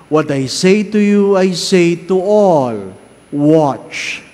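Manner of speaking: loud, measured liturgical reading; Gospel proclamation. Acted. Priest reads the Gospel aloud to the congregation, solemn proclamation. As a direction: proclaim it from the ambo, slowly.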